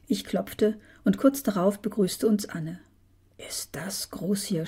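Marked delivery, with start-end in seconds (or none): 3.4-4.7 slow, softer wonder; quietly awestruck; amazed. A narrator's reading. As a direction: marvel at it in a hushed, drawn-out way. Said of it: "staunte Laurenz".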